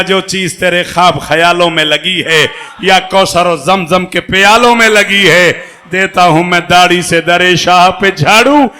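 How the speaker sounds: slow loud recitation building to a shout; reciting a couplet loudly. Real, not acted. Old man proclaiming his verse, slow and loud. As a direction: declaim the verse with rising force.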